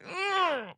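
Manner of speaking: pained wince. Retold or imitated. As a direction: flinch as if you felt the stubbed toe.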